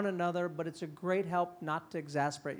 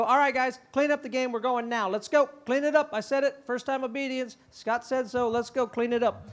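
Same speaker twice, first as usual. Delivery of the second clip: put-on loud, higher barked orders to the kids; bossy command; 5 semitones higher, 4 dB louder than usual. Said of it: "That's mean".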